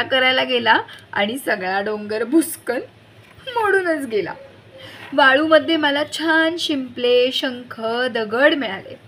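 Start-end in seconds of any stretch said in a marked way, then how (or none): none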